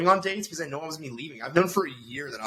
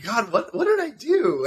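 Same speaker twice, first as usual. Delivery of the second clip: retold bewilderment; 8 semitones higher, slower than usual. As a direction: voice leaping high in mock disbelief. Retold bewilderment at being insulted.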